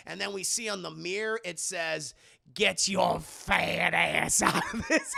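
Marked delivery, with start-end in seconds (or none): 2.5-5.1 slow, drawn-out spooky threat; ghostly warning. Acted. Narrator voicing the mirror's message, slow mock-menacing ghost. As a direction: read it as a haunting message, breaking into a laugh.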